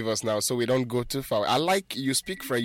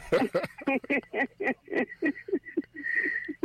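high, helpless laughter; laughing hard at the caller on air; long high laugh; 13 semitones higher than usual. Real, not acted. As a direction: keep laughing, can't stop.

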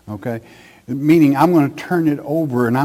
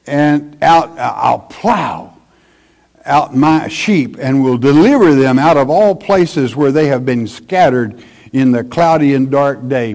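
louder, emphatic preacher's Bible reading; forceful scripture reading; 5 dB louder than usual. Acted. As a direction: proclaim the verse from the pulpit. Reads scripture aloud forcefully.

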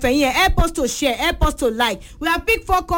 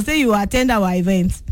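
normal talking voice

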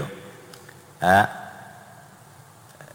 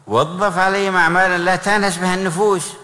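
high, louder reading of the text; raised preaching voice; 10 semitones higher, 4 dB louder than usual. Real, not acted. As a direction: lift the voice to stress the point.

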